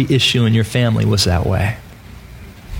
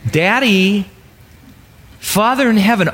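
normal talking voice

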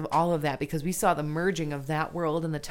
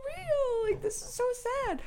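voicing the rabbit. Rabbit abandoned in the attic, very high and fading. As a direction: voice a forlorn little rabbit, high and thin. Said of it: "he's like".

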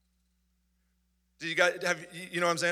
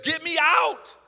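desperate plea; 9 semitones higher, 6 dB louder, slower than usual. Retold or imitated. Desperate airlift prayer.

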